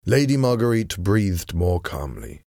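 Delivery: plain reading voice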